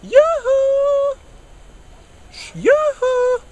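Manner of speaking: very high, falsetto yoo-hoo; a visitor calling into a house that hides from them; Jehovah's Witness calling at the gate in falsetto; sing-song door call. Acted.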